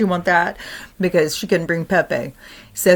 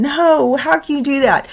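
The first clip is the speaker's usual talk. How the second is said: put-on much higher upset child voice; crying little girl; 9 semitones higher than usual. Granddaughter crying at grandpa, high child voice. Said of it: "the little girl's crying".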